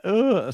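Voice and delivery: a short, high, mocking ugh of disgust. Podcaster mimicking the recruit's scornful reaction, mock ugh.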